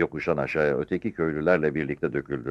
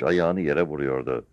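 usual speaking voice

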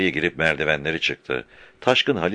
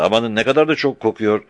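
panicked loud; 6 semitones higher, 4 dB louder than usual. A narrator's reading. Murtaza panics about the smell.

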